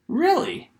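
high incredulous really; doubtful rising question; surprised cop. Retold or imitated. Cop surprised by hockey claim.

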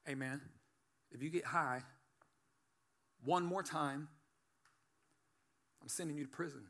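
low, softly spoken ultimatum from an officer; imitating a probation officer, low and soft; quiet stern warning. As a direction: lay down the threat quietly, with pauses. Officer's grave warning, retold.

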